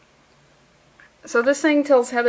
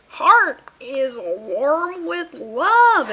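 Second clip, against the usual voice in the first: very slow, stretched-out swooning delivery; milk every word for syrupy drama; hammed-up, drawn-out tenderness; mock sentimental; 2 semitones higher, much slower than usual. Hammed-up sentimental line from the story.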